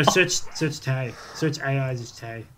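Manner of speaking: usual speaking voice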